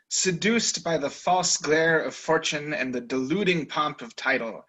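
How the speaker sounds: ordinary delivery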